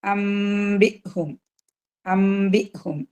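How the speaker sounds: അംബിഹും drawn out slowly with nasal hum; Quranic recitation demo. Shown as a demonstration. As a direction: recite അംബിഹും slow, holding the m. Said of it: "Then ഇവിടെ".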